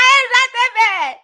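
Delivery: high, loud laughing protest; laughing shout. Real, not acted. Host protesting to chat, laughing and shouting.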